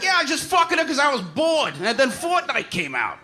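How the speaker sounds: high, careless mock boast. Comic pretending to trash his ex, mock careless boast. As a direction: toss it off like a smug ex. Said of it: "If I was just like".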